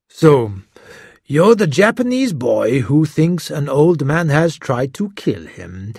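slow, probing detective voice; lightly voiced gruff detective. A narrator's reading. Detective to Hiro, slow and testing. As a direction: draw it out, sizing him up. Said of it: "the detective started".

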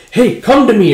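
loud, high call to come over. Acted. Grandmother coaxing the kid closer, calling out.

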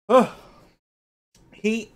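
hiccups breaking the words; hiccuping while eating. Real, not acted. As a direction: talk through hiccups. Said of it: "Good old hiccups".

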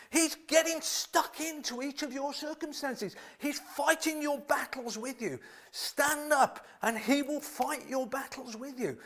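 high, loud, fired-up preaching; excited preaching. Real, not acted. Preacher getting carried away telling the church God fights their battles, excited. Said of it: "Sorry, I'm getting a bit excited here".

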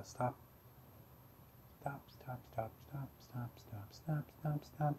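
very soft breathy repeats; whispered stop. Real, not acted. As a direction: murmur it under your breath. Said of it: "repeated very soft".

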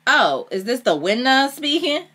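slow, high, loud mockery; mocking. A narrator's reading. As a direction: taunt him with a drawn-out, high-pitched question. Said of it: "I mocked him".

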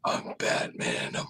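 hushed, slow superhero rasp; very soft, drawn-out growly whisper; Batman. Pretend. Guest jokingly gives his "real accent", Batman voice. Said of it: "Give me your real accent".

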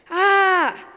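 loud, high scream of fright. Acted. Chicken snatched up by the eagle, screaming high and loud. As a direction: let out a piercing scream. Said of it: "screamed Chicken".

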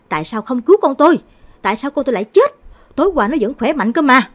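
loud, high raging outcry at the doctors; furious grief. Acted. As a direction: rage at them, demanding answers. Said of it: "điên cuồng đập phá". (người cha gào giận dữ trong bệnh viện)

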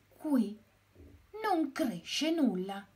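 lightly voiced astonished hen; exclaiming. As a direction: point out the bare patch in surprise. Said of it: "esclamò Gallinella".

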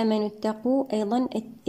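normal talking voice

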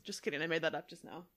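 ordinary talk

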